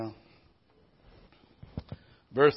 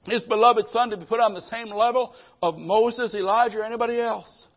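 loud, high, ringing proclamation; full-force preaching; 7 semitones higher, 5 dB louder than usual. Acted. Preacher proclaiming to the congregation, loud and ringing. Preacher declaring Christ's supremacy.

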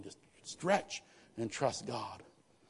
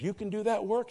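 higher, surprised employer voice; surprised rich lady; 10 semitones higher, 5 dB louder than usual. Retold or imitated. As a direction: pitch it up like an astonished lady. Rich lady's surprised question.

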